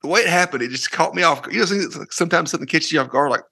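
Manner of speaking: normal talking voice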